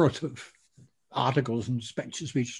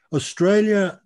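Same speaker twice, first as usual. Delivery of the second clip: ordinary talk, nothing marked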